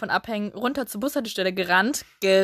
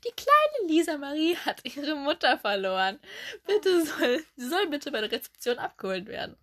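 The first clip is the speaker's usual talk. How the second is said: 1 mock store announcement; 5 semitones higher than usual. Durchsage im Supermarkt.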